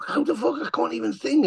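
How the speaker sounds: high-pitched, incredulous retelling; exasperated protest. Retold or imitated. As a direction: protest helplessly, pitch jumping up. Retold protest about lost voice.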